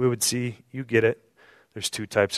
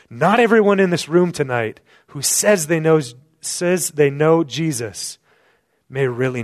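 building emphasis; 5 semitones higher, 7 dB louder than usual. Real, not acted. Climax of repeated list.

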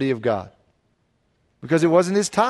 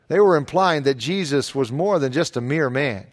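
usual speaking voice

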